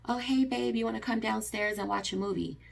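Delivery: high, soft, sugary invitation; sudden sweetness. Retold or imitated. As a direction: switch to sweet as if nothing happened. Sudden sweet switch after anger.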